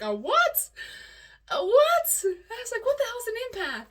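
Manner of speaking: high, loud squawk of disbelief; incredulous. Retold or imitated. Retelling her first hearing of "empath", incredulous and high.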